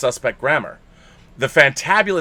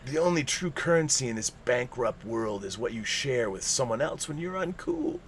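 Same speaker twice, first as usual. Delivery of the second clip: hushed quote; 9 dB quieter than usual. Retold or imitated.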